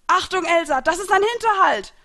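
high, loud warning shout; shouting from afar. A narrator's reading. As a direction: yell a warning across the distance. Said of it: "hörte ich Grays Stimme aus der Ferne". (aus der Ferne warnend gerufen)

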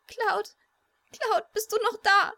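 high, loud, tearful crying out; crying scream. Acted. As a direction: cry out his name in tears. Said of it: "der Schrei ... war ihr eigener gewesen".

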